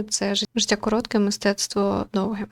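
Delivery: normal talking voice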